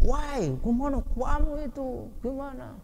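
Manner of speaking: high, slow angry yell; angry shouting. Acted. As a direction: scold someone at the top of your voice. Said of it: "dengan cara berteriak".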